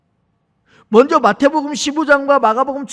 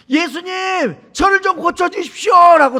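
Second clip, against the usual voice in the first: retold louder cry; 7 semitones higher, 3 dB louder than usual. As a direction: call out desperately as the blind beggar. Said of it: "더 큰 소리로 예수님을 부르는". (높고 큰 소리로 간청하며 부르짖는 흉내)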